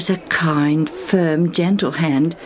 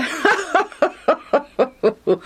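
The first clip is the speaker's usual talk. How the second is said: long, high rolling laugh; hearty laughter; 9 semitones higher than usual. A narrator's reading. Mister Legion joins Lucius, laughing heartily. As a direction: laugh along in a run of ha-has. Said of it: "Mister Legion joined Lucius in laughter".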